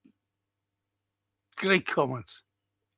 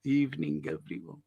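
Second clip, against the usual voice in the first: soft, fading evening greeting; a gentle, hushed hello to the chat; 2 semitones lower, 8 dB quieter than usual. Shown as a demonstration. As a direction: ease the greeting down gently to almost nothing. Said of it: "wasn't that gentle?".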